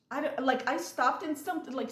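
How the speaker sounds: normal talking voice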